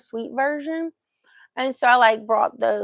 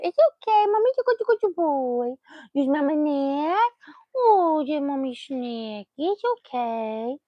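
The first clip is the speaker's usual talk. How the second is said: doting reassurance; 5 semitones higher than usual. Real, not acted. Owner reassuring her rescued dog, doting coo. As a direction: coo soothingly, stretching the words out.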